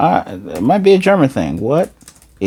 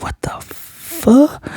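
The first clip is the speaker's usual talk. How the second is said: much higher, slow, breathless whisper; shocked whisper; much slower than usual. Retold or imitated. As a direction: gasp it out in stunned disbelief. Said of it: "Becky and Damien like".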